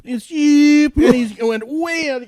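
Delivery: high, loud zoom of a bike flying past; excited zoom. Retold or imitated. Recalling the speeding biker to friends, a high loud zoom. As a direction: fling the word out like a speeding motorcycle.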